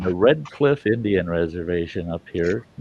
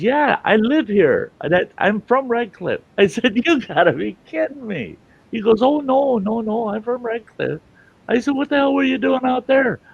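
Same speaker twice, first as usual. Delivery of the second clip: excited retelling; 4 dB louder than usual. Retold or imitated. Excited back-and-forth retold.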